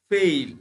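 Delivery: ফেইল loud, with a clear "ei" glide; demonstrated diphthong. Shown as a demonstration. Teacher gives learners the correct sound, loud gliding ফেইল. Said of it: "উচ্চারণটা হচ্ছে".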